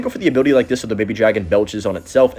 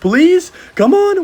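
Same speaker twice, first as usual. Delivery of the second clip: high, loud begging; will the tower down out loud; desperate pleading; 13 semitones higher, 5 dB louder, slower than usual. Real, not acted.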